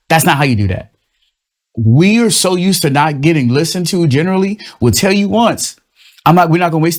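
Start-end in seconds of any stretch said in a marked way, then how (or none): none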